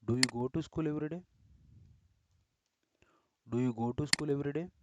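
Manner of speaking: teacher's model question ending up on day; dictated question. Shown as a demonstration. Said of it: "and one more example".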